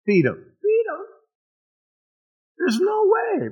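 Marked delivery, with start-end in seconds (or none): 0.6-1.0 squeaky falsetto disbelief; incredulous echo. Acted. Disciples stunned at being told to feed thousands, incredulous squeak. 2.6-3.5 very high, squeaky disbelief; incredulous protest. Acted. Disciples balking at feeding five thousand men, incredulous squeal.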